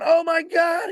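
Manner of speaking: high, startled oh my God; excited gasp. Retold or imitated. Buddy panicking at the fish, high and startled. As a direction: Russ reacting as the fish charges. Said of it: "Russ is going".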